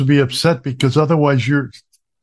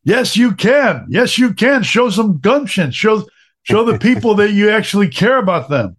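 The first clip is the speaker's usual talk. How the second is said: put-on higher, insistent old-school lady voice; Renee's insistence; 7 semitones higher than usual. Caregiver badgering the speaker about welfare, insistent. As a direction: push it, nagging her point. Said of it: "I'm like, "Okay, Renee".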